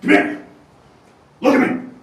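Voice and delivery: loud barked command; shouting. Acted. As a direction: yell at her to look. Villain grabbing hostage.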